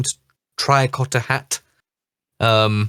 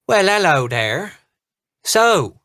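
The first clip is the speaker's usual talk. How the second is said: halfling's thick Irish brogue, a little higher; 7 semitones higher, 3 dB louder, slower than usual. Acted. Halfling captain greets the party in a thick Irish brogue. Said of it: "a very thick brogue comes out".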